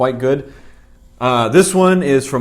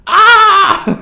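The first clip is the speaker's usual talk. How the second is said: high, loud mock scream of a man; imitated scream; 12 dB louder than usual. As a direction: squeal it like a tiny man screaming. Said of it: "It goes".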